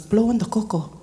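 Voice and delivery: imitated uncle's punchline instruction; retold playful command, in a changed voice; cheeky order. Uncle changing the subject to his cocoa, cheeky.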